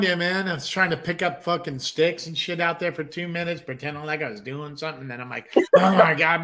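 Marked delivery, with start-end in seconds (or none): 5.8-6.4 panicked gasp. Retold or imitated. Retelling paranoia about the neighbours.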